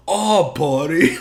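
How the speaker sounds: Louis J Gomez impression. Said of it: "Dave Smith's impression of Louis J Gomez".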